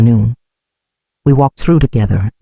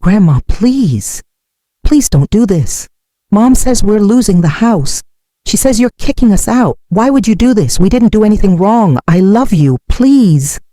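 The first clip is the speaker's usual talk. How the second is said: high-pitched mild sobbing plea; a touch of tears in a higher voice; tearful pleading; 7 semitones higher than usual. A narrator's reading. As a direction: plead as an upset grandchild, pitched up. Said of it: "sobbing so hard she could barely speak".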